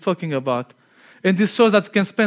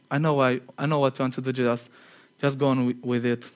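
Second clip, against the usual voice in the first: quiet knowing Jesus; 7 semitones lower, 7 dB quieter than usual. Acted. Jesus voiced speaking to Judas.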